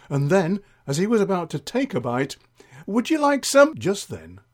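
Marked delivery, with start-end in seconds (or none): 2.8-3.7 high-pitched eager offer; sing-song high voice of an attentive server; fussing Doris. Acted. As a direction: pipe up sweetly to interrupt, pitch way up. Doris interrupting yet again.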